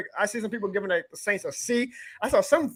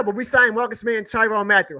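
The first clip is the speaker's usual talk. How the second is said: usual speaking voice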